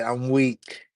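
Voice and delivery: ordinary talk, nothing marked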